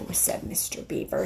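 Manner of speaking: plain reading voice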